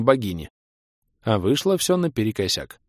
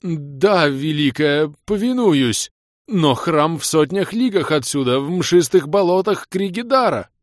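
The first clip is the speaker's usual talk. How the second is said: high pleading servant; 8 semitones higher, 4 dB louder, slower than usual. A narrator's reading. Bearded man pleading high.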